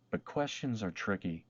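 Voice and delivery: ordinary delivery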